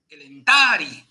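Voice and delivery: "el entari" con la A larga, marcada y sostenida; vocal larga. Shown as a demonstration. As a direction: alarga bien la "a" de "el entari". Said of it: "Eso se pronunciaría el entari". ("el entari" con vocal larga acentuada)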